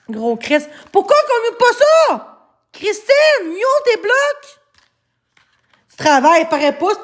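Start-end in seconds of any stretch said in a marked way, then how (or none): 0.9-4.6 high, slow, drawn-out demand to the mod; exasperated high order, slow; raised exasperated call. Real, not acted. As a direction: call her out, pitched up and stretched. Calls out to mod Christine.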